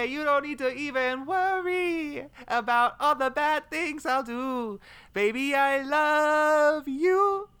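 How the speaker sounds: high, slow crooning proposal voice; over-the-top romantic proposal; hammed-up lover. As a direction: croon it like a lovesick suitor. Timed improv proposal, put-on lover voice.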